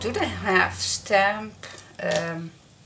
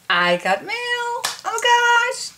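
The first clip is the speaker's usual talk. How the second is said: excited; 8 dB louder than usual. Real, not acted. Excited unboxing of mail.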